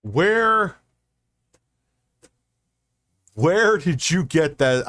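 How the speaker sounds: where did you get that, in disbelief; incredulous. Real, not acted. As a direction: demand a source, stunned. Incredulous reaction to claim.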